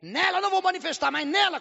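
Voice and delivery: the demon answering back; defiant demon voice. Retold or imitated. Possessed woman's demon defies the pastor, high loud taunt. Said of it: "Aí ela falou assim".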